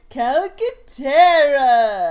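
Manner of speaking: slow, drawn-out cheer; excited shout. Real, not acted. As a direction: belt the name like a fan. Cheering the name.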